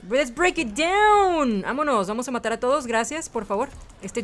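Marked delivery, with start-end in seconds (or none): 0.1-1.6 raised, long-held "down"; hype catchphrase yell. Pretend. Player firing Lucio's ultimate, hype yell. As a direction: belt out the slogan, stretching the end.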